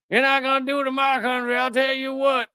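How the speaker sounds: put-on high-pitched Southern old Confederate general caricature; hammed-up Southern twang, pitched well up; Marco Rubio. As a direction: crow it like a cartoon Southern general. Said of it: "old Confederate general".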